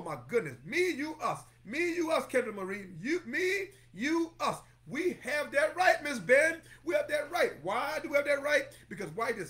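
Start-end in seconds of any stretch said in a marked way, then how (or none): 0.6-8.6 higher, louder chanted preaching; whooping preacher at full force. Acted. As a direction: build the congregation up, call and response.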